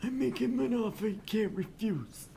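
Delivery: hammed-up Italian mobster; Godfather impression. Quoting the Godfather to the table in a hammed-up Italian voice. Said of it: "more like The Godfather".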